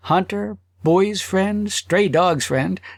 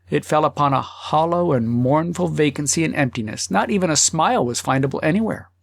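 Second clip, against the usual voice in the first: usual speaking voice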